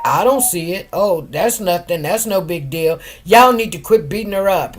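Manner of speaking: low, soft, brushing it off like a judge; judge's dismissive voice. Retold or imitated. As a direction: mimic the judge waving it all away. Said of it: "The judge is acting like".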